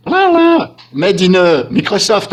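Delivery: high, loud "Made in Microsoft" in an English accent; excited mock call. Real, not acted. YouTuber mocking Microsoft, high loud English accent. Jokes about Microsoft update.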